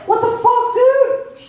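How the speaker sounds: high, incredulous what the fuck; outraged disbelief. Retold or imitated. Comic to the guy asking for change back, outraged disbelief. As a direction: shoot up in pitch, stunned. Outraged reaction to his own retold quote.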